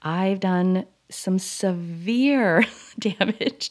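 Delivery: laughing through the words, slowly; rueful laugh. Real, not acted. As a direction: laugh it off slowly, not because it's funny. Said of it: "I'm laughing".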